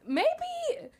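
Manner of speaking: high-pitched dawning self-doubt; mock realization. Mock realization of being short.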